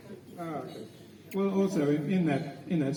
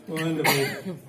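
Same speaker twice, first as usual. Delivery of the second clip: normal talking voice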